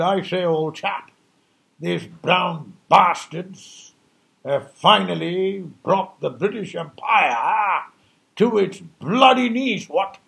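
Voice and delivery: slow, hammed-up posh British English; mock British officer. Mocking the British who lost India, slow posh British voice. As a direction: drawl it like a pompous British colonial. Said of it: "And the British said".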